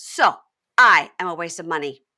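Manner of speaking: loud, punched imitated retort; mock indignation. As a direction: hit "So" and "I" hard, playing offended. Retelling her wounded retort.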